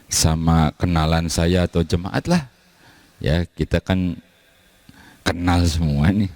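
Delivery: ordinary talk, nothing marked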